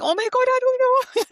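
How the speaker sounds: high, loud flustered panic; panicked. Retold or imitated. Teammate quizzes her, she squeals in panic. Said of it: "I'm like".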